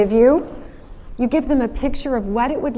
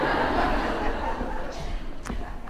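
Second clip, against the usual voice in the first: real laugh; 9 dB quieter than usual. Real, not acted. Audience and speaker laugh.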